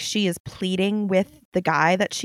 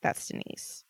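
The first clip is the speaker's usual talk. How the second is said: whisper; 13 dB quieter than usual. Retold or imitated. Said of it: "they were like".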